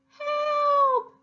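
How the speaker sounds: very high, loud cry for help; screaming for help. Acted. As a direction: shriek it in panic. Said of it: "the harp screamed".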